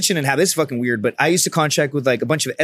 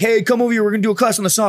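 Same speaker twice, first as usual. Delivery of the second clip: calling out; 7 semitones higher, faster than usual. Retold or imitated. Team leader voiced in story.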